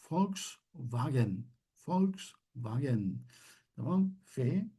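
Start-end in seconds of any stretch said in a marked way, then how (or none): 0.1-1.5 Volkswagen drawn out slowly, V said as F; slow model word. Shown as a demonstration. Teacher slowly models Volkswagen for class. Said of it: "Bakın". 1.9-3.2 Volkswagen again drawn out slowly, V said as F; slow repeat. Shown as a demonstration. Teacher repeats Volkswagen slowly for students. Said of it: "Bakın".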